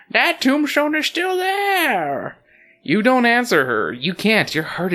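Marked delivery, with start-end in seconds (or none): 0.1-2.4 very high, drawn-out granny voice; high cartoon granny insisting; scolding grandmother. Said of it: "she scolds you".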